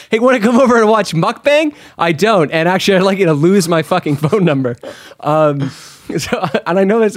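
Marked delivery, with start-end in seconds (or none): 0.1-1.7 high, bright mock invitation; chirpy invite voice. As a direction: imitate an eager friend. Mimicking friend's invitation.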